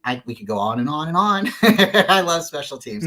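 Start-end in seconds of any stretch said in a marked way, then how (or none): 1.5-2.0 loud, high laugh; burst of laughter. Real, not acted. Talking about special teams, a loud happy laugh. 2.1-3.1 said through a laugh. Real, not acted. Confessing love for special teams, laughing.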